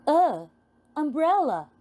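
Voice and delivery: ordinary delivery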